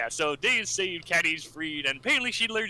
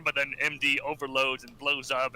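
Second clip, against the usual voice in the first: normal talking voice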